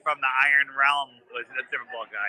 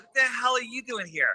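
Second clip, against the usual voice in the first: shocked demand; 6 semitones higher than usual. Retold or imitated. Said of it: "I'm like".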